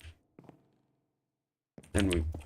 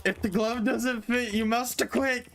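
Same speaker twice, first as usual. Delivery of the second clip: high mock lawyer plea, quicker; courtroom quote; 9 semitones higher than usual. Repeating the glove line to a friend, high mock lawyer. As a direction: repeat the defence line with a grin.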